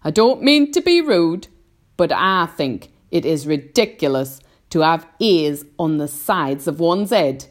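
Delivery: loud, brash insect voice; cheeky centipede. A narrator's reading. Centipede mocks James's ears, loud and cheeky. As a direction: taunt loudly with a grin. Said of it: "the centipede said, grinning o-, away as usual".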